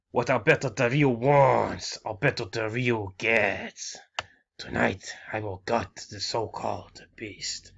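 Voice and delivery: put-on slow, drawn-out Spanish accent; Del Rio Spanish accent. Del Rio promising to gut the beast, slow Spanish accent. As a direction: boast slowly like Del Rio in a thick accent. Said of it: "kinda trying to sound like Del Rio".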